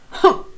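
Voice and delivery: loud offended huff; loud humph. A narrator's reading. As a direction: huff it out in annoyance.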